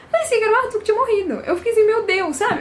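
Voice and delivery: high and fast, racing through the panic; worked-up rush. Retold or imitated. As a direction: voice jumping up, rushing the words out. Distraught recalling imagined death.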